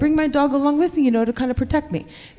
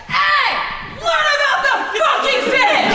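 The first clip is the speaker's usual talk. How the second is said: mock yell; 13 semitones higher than usual. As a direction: yell it high like an annoyed mom. Mom yelling at singing kid.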